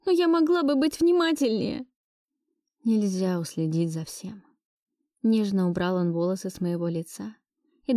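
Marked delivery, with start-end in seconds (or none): 0.0-1.9 high, distressed self-reproach; tearful self-blame. Acted. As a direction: blame yourself, close to tears. (виня себя, высоко, почти плача)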